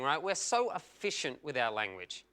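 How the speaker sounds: usual speaking voice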